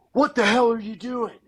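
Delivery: loud, high, furious demand; a stranger yelling at a hunter; angry shout. Retold or imitated. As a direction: bark it in his face. Stranger confronts him nose to nose.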